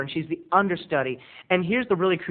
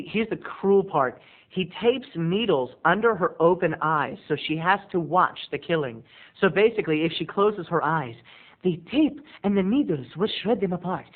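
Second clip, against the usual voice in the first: ordinary delivery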